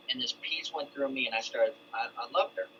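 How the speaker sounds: normal talking voice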